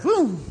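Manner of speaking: high "woo"; quick mock-revival whoop; playful high cheer; celebratory whoop. Mimics fiery preaching's result.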